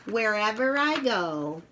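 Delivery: lively picture-book read-aloud voice; reading to children. A narrator's reading. Reading the senses book aloud to young children, lively. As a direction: read it brightly to little kids.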